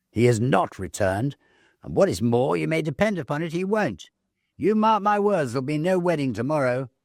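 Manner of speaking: smug sister. Acted. Sister Fanny predicting doom.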